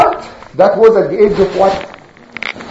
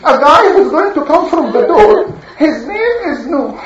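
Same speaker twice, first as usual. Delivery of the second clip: much higher, silly mimicking of a preacher; mocking imitation; 13 semitones higher than usual. Townspeople mocking Noah, high silly parody. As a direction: parody Noah's warning with a laugh. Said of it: "they mock him like this and say".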